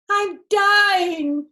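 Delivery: very high, loud melodramatic cry; hammed-up wail. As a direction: overact the pain like a big baby. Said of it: "cried Fox".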